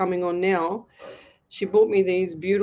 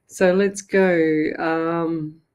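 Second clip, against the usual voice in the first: ordinary delivery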